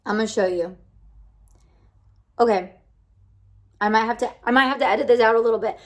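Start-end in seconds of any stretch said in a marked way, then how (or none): none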